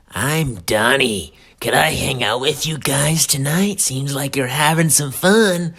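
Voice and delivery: slurry, over-friendly party crasher; drunk intruder. Acted. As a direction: invite yourself in with drunken cheer. Drunk self asks to join.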